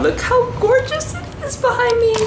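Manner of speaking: very high, loud squeal of delight; gushing squeal. Real, not acted. Traveler showing viewers the gorgeous room, squealing in delight. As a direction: gush over the view at a squealing pitch.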